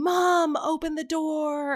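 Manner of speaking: high, drawn-out imitation of a wailing kid; retold kid's cry without the volume; child calling for mom. Child shut out calling to mom, imitated high and drawn-out. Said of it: "screaming and screaming".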